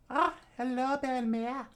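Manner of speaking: put-on very high child voice; put-on small-girl falsetto; little girl's greeting. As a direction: greet in a tiny, squeaky voice. Child Nami greets foster mother.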